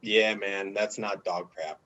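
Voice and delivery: low, soft deadpan imitation; understated, dropped-down voice; Mike York. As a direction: mumble it like an unbothered teammate. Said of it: "And Mike York goes".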